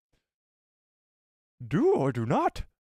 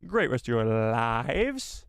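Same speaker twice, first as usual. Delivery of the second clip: dragged out; 2 semitones lower, much slower than usual. Real, not acted. Drawn out sign off.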